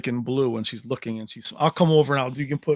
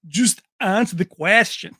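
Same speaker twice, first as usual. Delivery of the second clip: mock Van Damme accent, louder one-liner; Van Damme impression; 4 semitones higher, 7 dB louder than usual. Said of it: "their accent trying to do these one-liners".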